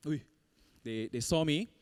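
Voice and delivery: ordinary talk, nothing marked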